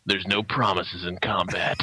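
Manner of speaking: very low, slow tough-sergeant delivery; deadpan. Retold or imitated. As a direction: state it grimly, with no comfort at all. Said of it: "deadpan, goes".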